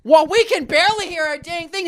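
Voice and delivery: high-pitched griping impression; imitated old man complaining. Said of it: "He goes".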